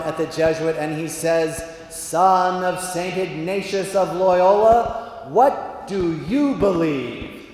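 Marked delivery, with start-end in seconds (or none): 1.9-7.5 slow, loud intoned question; God. Acted. God questions the Jesuit, slow and majestic. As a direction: proclaim it with drawn-out grandeur. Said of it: "that's how he talks".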